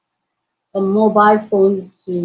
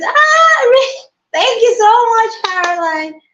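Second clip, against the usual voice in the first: overjoyed squeal; 12 semitones higher than usual. Real, not acted. Streamer thanking a viewer for a compliment, overjoyed squeal. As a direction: squeal with delight at the kind words.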